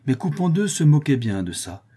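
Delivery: usual speaking voice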